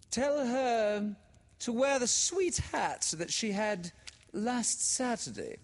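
high, carrying mother's call; a high, drawn-out call from another floor; floating down the stairs. A narrator's reading. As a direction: sing it out from upstairs. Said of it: "Miss Sheridan's voice floated down the stairs".

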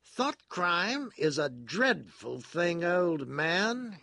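high, loud moralising character voice; sententious. A narrator's reading. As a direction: pronounce it solemnly, slow and emphatic. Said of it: "he said sententiously".

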